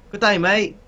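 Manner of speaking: high, put-on Aussie greeting; Australian accent attempt. Shown as a demonstration. Friend starting a round of accent attempts, mock Australian. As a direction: try a cheesy Australian accent. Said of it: "try to do it on Australian accent".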